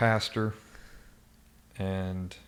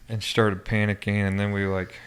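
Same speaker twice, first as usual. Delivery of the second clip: ordinary talk, nothing marked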